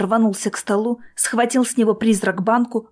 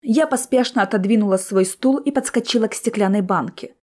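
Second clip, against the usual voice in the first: ordinary delivery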